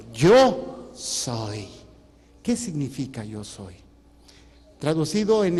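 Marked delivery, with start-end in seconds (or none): 0.1-1.7 a hint of a lower, solemn divine voice; voice of God. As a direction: say it as God answering. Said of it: "¿Qué les dijo?". (voz de Dios, apenas más grave y solemne)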